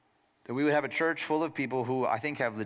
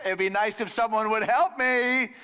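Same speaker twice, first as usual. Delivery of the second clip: passive-aggressive hint; 8 semitones higher, 5 dB louder than usual. Acted. Martha's passive-aggressive hint voiced.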